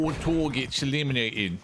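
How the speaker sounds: put-on, not very good British voice; British accent. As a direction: mimic the game announcer in a bad British accent. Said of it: "That was my British voice. It's not a very good one".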